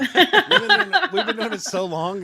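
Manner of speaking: words bursting out through laughter; laughing. Real, not acted. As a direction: laugh hard while saying it. Laughing after the story.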